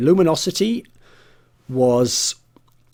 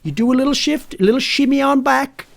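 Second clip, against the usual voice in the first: hammed-up Red Deck accent; 9 semitones higher than usual. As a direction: ham it up like a yokel coach bragging. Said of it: "This is terrible".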